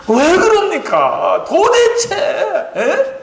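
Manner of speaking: high, slow, exasperated plea with a laugh; a high, half-laughing plea; exasperated Joseph. Retold or imitated. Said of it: "요셉이가 막 울었어요". (형들에게 답답해하며 높게 하소연하는 요셉)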